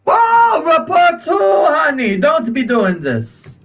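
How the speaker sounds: high, loud startled "whoa" then huffy; an offended little shopkeeper; indignant shopkeeper. Acted. Shopkeeper scoffing at the threat of being reported, indignant and high.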